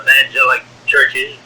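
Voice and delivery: ordinary talk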